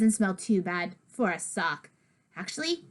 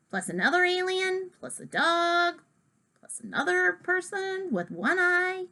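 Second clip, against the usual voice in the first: higher, playful listing of odd creatures; amused explaining; 8 semitones higher than usual. Retold or imitated. Narrator describing Dory's silly drawing, playful higher voice. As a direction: list them with growing amusement, pitched up.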